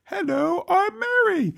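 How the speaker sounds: very high, sing-song prissy mock voice; mock her bland accent in a falsetto; Mary's accentless voice mocked, very high falsetto; mock Mary Queen of Scots. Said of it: "she's like".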